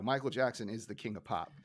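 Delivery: ordinary delivery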